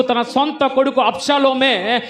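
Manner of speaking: loud, high-pitched denunciation from the pulpit; full-force preaching. Real, not acted. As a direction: thunder it out to the congregation.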